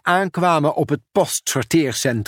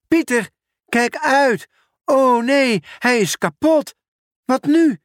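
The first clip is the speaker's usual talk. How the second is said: much higher, louder panicked call; alarmed cry; 12 semitones higher, 3 dB louder than usual. Acted. Ben warns Pieter as the machine breaks, high panicked shout.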